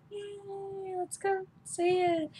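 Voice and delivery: high, airy fake cheer, not meant; sarcastic little squeal of joy; mock excitement. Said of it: "jumping up and down going".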